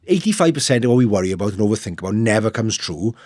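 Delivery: usual speaking voice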